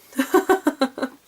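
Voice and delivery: bursting out laughing; a loud happy laugh. Real, not acted. Laughs at the result.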